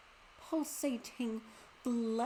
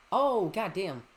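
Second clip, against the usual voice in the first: usual speaking voice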